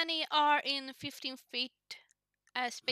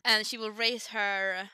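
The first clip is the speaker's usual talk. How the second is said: ordinary talk, nothing marked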